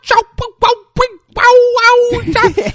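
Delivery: loud, high-pitched mocking syllables; hammed-up imitation of a mocker; mocking noises. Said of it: "like, offensive noises".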